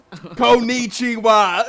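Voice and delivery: very slow, high, loud hammed-up greeting, laughing; drawn-out mock Japanese hello; Duke's accent in Japanese. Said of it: "Doesn't lose his accent".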